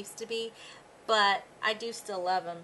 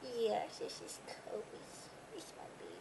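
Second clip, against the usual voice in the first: whispered to dog; 10 dB quieter than usual. Real, not acted. Owner whispering fondly to her dog Kobe. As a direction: breathe it to the dog like a secret.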